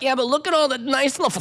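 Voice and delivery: sing-song mockery of a fussy host; mock flower defender. Imagined person defending wedding flowers.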